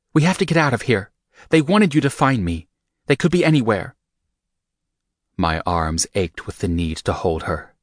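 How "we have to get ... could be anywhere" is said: higher, louder urgent female voice; Rika, urgent. A narrator's reading. Rika urges Michael to get off the yacht, urgent. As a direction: Rika pressing him to hurry.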